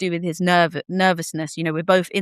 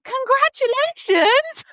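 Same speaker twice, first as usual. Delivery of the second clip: very high, slow gushing congratulations; gushing friend; slower than usual. Retold or imitated. Doula friend congratulating new mother, slow gushing high voice. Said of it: "she was like".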